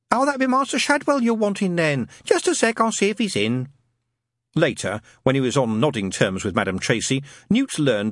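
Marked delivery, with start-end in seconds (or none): 0.1-3.7 high, motherly Cockney-ish lady voice; all "love" and welcome, higher; chatty landlady. Acted. Madam Tracy answering Newton's call, homely and high.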